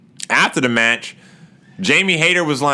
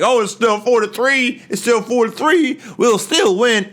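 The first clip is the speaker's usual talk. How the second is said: imitated boast; 12 semitones higher than usual. Jericho voiced boasting, higher pitch.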